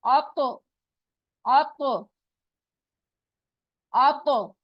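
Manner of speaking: आप तो with a bounced, echoing t; bouncing echo. Shown as a demonstration. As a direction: let the तो snap and bounce back. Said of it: "टकरा के वापस आएगी".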